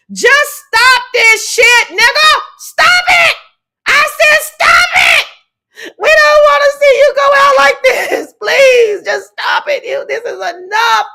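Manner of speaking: hammed-up crying scream, begging at full force; clamoring plea. Imagined fans beg him to stop, hammed-up wailing shout. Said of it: "they are clamoring to talk to him".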